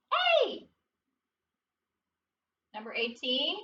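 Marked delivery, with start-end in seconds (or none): 0.1-0.7 a sudden high "Hey!"; bright yelp of surprise; excited shout. Real, not acted. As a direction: call out with a jolt of excitement. Excited call out.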